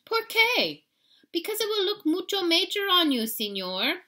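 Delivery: high, loud little dog voice; cheeky pup, high and loud; chihuahua voice. Acted. Pup teasing the kitten, high and loud.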